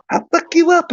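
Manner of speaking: put-on very high girly voice; Mike Tyson. Abusive phone call from a stranger, high girly voice. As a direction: threaten in a squeaky, girlish pitch. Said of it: "just got a girly voice".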